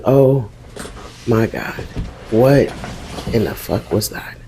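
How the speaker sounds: fading to a near whisper; barely breathing it out in the dark; shaken quiet. Retold or imitated. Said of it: "And I said".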